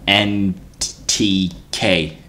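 stumbling over the acronym with long gaps; searching for each letter, with pauses between; halting stutter. Real, not acted. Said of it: "Why did I stutter so hard".

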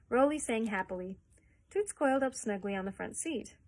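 ordinary delivery

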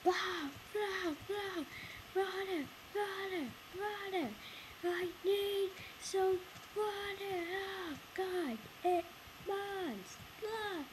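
squeaky-high mock pleading; put-on high voice. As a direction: pitch it way up and call out in bursts. Acting out needing water in game.

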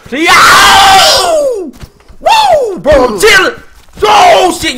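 very high, loud wails; shrieking, frantic pleas; panicked screaming. Real, not acted. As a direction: howl in pain and beg off. Attacked and pleading in game.

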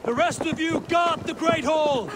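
battle shout. Acted. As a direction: yell orders over the battle. Leading charge into battle.

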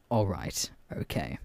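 low, quiet giving-up; resigned. Retold or imitated. Reacting to her dismissive reply, resigned. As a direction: sink down into a soft resignation.

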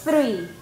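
Three with the tongue grazing the teeth; teacher's model three. Shown as a demonstration. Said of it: "¿Ves? Ese sonidito que los dientes".

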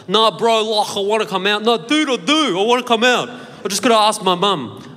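put-on pleading in a thick ethnic Australian accent. Teen on the phone to his mates in ethnic Australian accent. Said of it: "spoke in that ethnic accent".

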